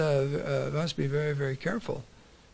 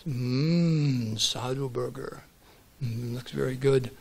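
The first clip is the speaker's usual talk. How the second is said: lightly voiced lion savoring his prey; a lion relishing a meal; hungry lion; 3 semitones lower, much slower than usual. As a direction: eye the sage like lunch. Lion eyes the sage hungrily.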